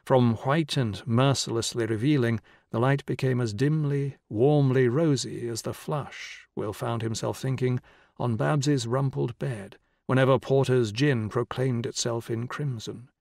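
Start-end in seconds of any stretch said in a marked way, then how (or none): none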